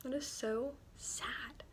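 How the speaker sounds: slow, hushed whisper; whispered to herself after reading the quote; sad whisper. Retold or imitated. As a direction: breathe it out softly, saddened. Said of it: "And I was like".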